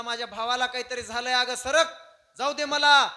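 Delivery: high, loud, mounting urgency; urgent demand. Acted. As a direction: shout to get past and run to help. Brother demands to be let go.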